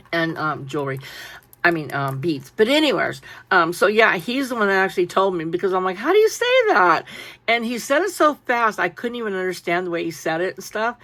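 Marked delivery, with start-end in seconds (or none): 6.0-7.0 high mock question. Asking the seller for pronunciation.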